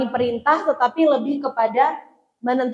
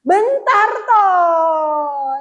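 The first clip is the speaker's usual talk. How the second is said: very slow, higher, louder stretched-out whine; whiny child reply; 4 semitones higher, 5 dB louder, much slower than usual. Retold or imitated. Child on phone stalling mother, slow higher whine. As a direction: drag it out like a child glued to the phone. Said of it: "Jawabannya biasanya".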